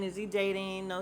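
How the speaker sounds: ordinary talk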